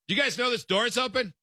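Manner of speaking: put-on high-pitched, pointed question to the kids; incredulous parent. Parent to kids standing by open door, high incredulous question. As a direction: imitate yourself calling out oblivious children.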